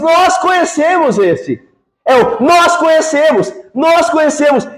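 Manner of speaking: hammed-up self-assured know-it-alls; smug boast. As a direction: mimic proud religious men insisting. Judeus boast, quoted mockingly.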